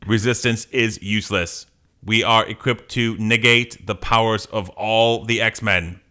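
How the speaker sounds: mechanical villain monotone; robot voice. Acted. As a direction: state it as a machine would. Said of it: "Are you gonna do a robot voice?".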